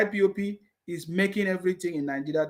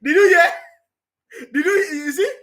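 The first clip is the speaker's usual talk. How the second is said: higher, louder laughing question; incredulous laugh; 6 semitones higher, 7 dB louder, faster than usual. Real, not acted. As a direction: laugh in disbelief.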